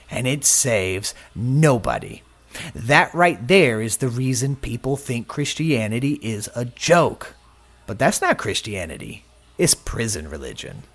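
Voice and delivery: usual speaking voice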